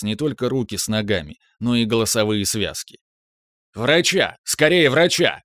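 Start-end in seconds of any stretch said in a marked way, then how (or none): 3.7-4.4 urgent call for a doctor; man down shout. Acted. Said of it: "прокричал Франц Конрад фон Хёцендорф". 4.4-5.4 louder, higher, desperate shout; man down shout. Acted. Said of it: "прокричал Франц Конрад фон Хёцендорф".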